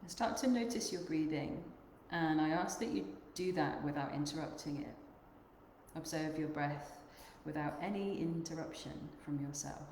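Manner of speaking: unhurried meditation guide, long pauses between phrases; guided meditation. Acted. Yoga teacher guiding the group to notice their breath, guided meditation. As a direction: lead them gently inward, leaving long silences.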